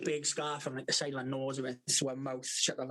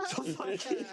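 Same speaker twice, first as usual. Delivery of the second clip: laughing; 7 semitones higher, slower than usual. Real, not acted. Laughing recalling the sled.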